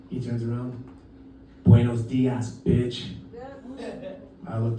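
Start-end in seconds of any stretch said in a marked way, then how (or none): 1.6-3.1 low, understated challenge; cool confrontation. Retold or imitated. Tourist taps shoe thief on shoulder, cool confrontation. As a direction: drop it low, deadpan to the thief.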